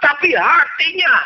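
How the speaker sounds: impassioned preacher; fiery preaching. Acted. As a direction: drive the warning home to the congregation. Preaching at full force.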